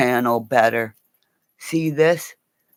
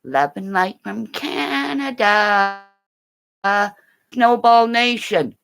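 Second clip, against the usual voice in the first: "Love and light from Canada" sung out higher with a stretched "Canada"; raised sign-on; 8 semitones higher, 3 dB louder than usual. Real, not acted. Streamer greeting his viewers with a lifted, higher greeting. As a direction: call it out brightly to the chat.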